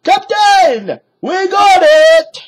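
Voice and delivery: very high, loud pirate shout. A narrator's reading. Pirate calls to his captain, high loud shout. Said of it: "shouted Pinky".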